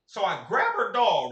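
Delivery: usual speaking voice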